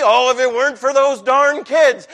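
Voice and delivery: a hint of a grumbling cartoon crook; Scooby-Doo villain. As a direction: toss off the foiled villain's line. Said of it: "You didn't get to hear them say".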